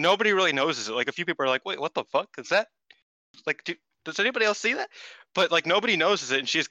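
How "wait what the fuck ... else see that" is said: high-pitched, baffled questioning; retold disbelief. Fans spotting Hazuki before anyone else, high disbelief. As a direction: imitate stunned viewers, pitch climbing as it goes.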